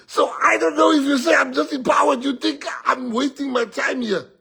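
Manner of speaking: high, loud rally oratory; impassioned shouting. Real, not acted. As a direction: proclaim it defiantly to the crowd. Politician proclaiming want of power to crowd.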